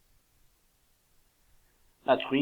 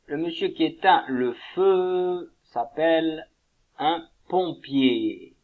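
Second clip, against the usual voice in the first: slow teaching voice; 3 semitones higher, 5 dB louder, slower than usual. Shown as a demonstration. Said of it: "teacher sounding out new word".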